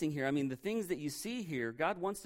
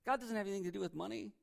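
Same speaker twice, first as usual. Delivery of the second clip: soft, higher-pitched sceptical murmur; doubting whisper, voiced; 4 semitones higher, 7 dB quieter than usual. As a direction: slip the doubt in very quietly. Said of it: "He just whispers these doubts in your ears".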